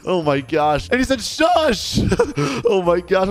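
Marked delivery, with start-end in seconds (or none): 1.2-2.0 high-pitched sharp hush; yelling teacher. Retold or imitated. Strict teacher shushing student, mock yelling. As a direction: cut them off with a high mock yell. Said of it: "I'm still getting yelled at".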